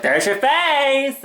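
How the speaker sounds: high, drawn-out gadget announcement; camera announcing a face. Acted. Camera announces the face to its owner, high drawn-out call. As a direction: stretch it out high, like an excited device.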